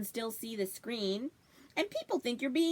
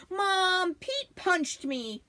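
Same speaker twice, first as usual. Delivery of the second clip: put-on a child tattling, higher and louder on Mom, slow; tattling whine; 8 semitones higher, 4 dB louder, much slower than usual. Toby tattling to Mom about his brother, whiny.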